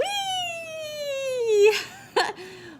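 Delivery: high delighted squeal; long high whooping laugh; gleeful whoop. Acted. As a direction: squeal with joy. Whee while flying.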